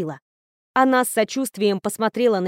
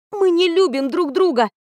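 holding back a shout, much higher; almost shouting; 7 semitones higher than usual. Acted. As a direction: nearly yell it, just short of shouting. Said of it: "почти кричала я". (почти крик)

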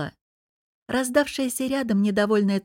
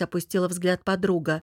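ordinary delivery

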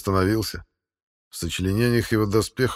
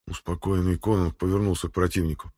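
plain reading voice

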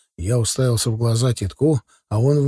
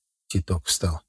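ordinary delivery, nothing marked

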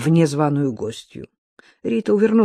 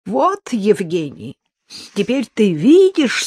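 put-on outraged old woman; 5 semitones higher, 3 dB louder than usual. A narrator's reading.

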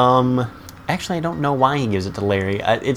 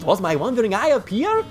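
high-pitched character voice, climbing higher; Balky. Acted. Balky carrying on the poem without missing a beat, high character voice. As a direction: keep reciting, rising to a high held finish. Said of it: "he eventually opens up the closet again".

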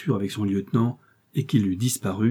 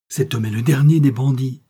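louder, raised-pitch denunciation; emphatic, indignant raised voice; indignant exclamation; 4 semitones higher, 6 dB louder than usual. A narrator's reading. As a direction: denounce a villain with outrage. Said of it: "s'écria Paulin Broquet".

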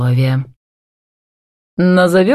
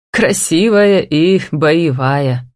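ordinary delivery, nothing marked

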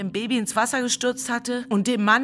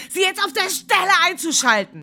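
retold order; 9 semitones higher, 6 dB louder than usual. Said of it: "ich befahl ihm".

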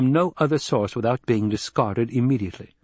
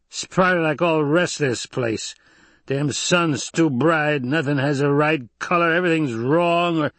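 put-on drunken grumbling; 5 semitones higher than usual. Idaho, drunk, rejects Yueh's coffee, grumbling drunkenly. Drunk man complains in the story.

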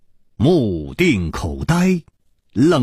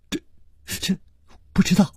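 hesitant faltering; 9 semitones higher, faster than usual. A narrator's reading. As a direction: stall on the first word, then admit it. Character falters at a question.

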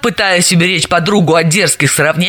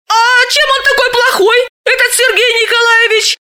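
surprised. A narrator's reading. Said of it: "удивилась Галина".

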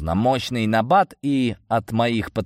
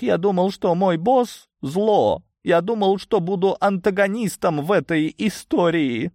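crying; 10 semitones higher than usual. A narrator's reading. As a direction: lament through tears in a high whine. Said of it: "плакал волшебник". (плачет, высоко и протяжно причитает)

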